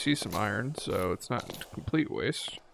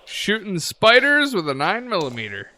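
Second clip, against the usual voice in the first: louder, higher gleeful restatement; excited repeat; 8 semitones higher, 8 dB louder than usual. Real, not acted. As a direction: savour the line with glee. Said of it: "Sentence I never thought I'd say".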